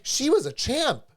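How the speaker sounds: chirpy vet tech. Retold or imitated. Vet tech breezily tells the worried owner it went well, cheerful mock voice. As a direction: mimic the tech's breezy all-is-well tone.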